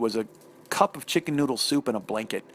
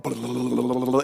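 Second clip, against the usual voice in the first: tongue-trilled drum roll; imitated drum roll; 3 semitones lower than usual. Comic telling the wait for the sandwich, mouth-made drum roll. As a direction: roll the tongue like a snare building up. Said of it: "I kept hearing a drum roll".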